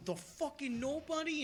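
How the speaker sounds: high, incredulous squeak of disbelief; incredulous son. Retold or imitated. Son reacting to his father's warning, shocked and incredulous. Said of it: "He's like".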